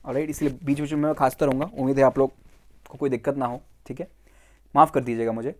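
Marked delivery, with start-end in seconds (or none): none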